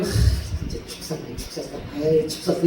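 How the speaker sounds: dejected sigh. Retold or imitated. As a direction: sigh it out like a preacher sure the sermon flopped. Pastor sighing after a bad sermon. (설교를 망쳤다고 한숨 쉬는 목사, 힘없이 속삭이듯)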